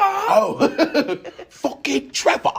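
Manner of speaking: high, loud mock outburst through laughter; hammed-up echo of an overacted curse. Co-host echoing the impression, high and laughing. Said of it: "That's what he sounds like".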